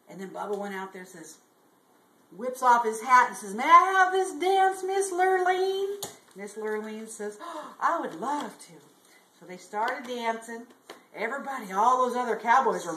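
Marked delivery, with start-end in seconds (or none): 3.5-6.0 high, loud, drawn-out request; gallant invitation. Acted. Bubba grandly asks for a dance. 7.4-8.7 delighted gasp, then gushing; swooning belle. Acted. Miss Lurleen accepts the dance.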